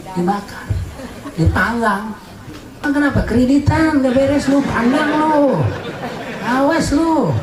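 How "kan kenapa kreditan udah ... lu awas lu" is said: high, mocking taunt of a sinner; lightly voiced taunting demon. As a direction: jeer at them in a raised comic voice. Preacher mocks sinners in high voice.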